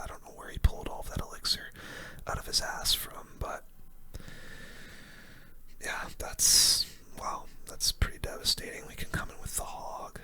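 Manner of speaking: ordinary talk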